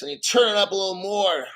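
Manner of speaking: ordinary talk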